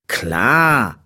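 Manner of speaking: lightly voiced know-it-all big brother; big-brotherly. A narrator's reading. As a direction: answer with a touch of big-brother assurance. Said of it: "auf eine sehr großer, brudermäßige Art". (leicht großbrüderlich, selbstsicher)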